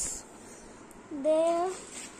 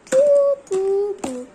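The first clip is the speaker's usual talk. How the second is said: loud playful boos; 3 semitones higher, 8 dB louder than usual. Real, not acted. Playful noises.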